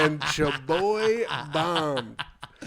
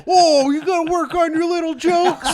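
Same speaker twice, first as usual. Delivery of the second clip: mock bullying shout; 13 semitones higher, 7 dB louder than usual. Comic bullying rivals over their notebooks, mock jeering shout. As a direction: heckle them in a hammed-up holler.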